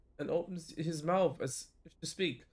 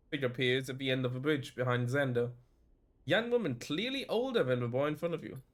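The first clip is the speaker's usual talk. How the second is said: ordinary delivery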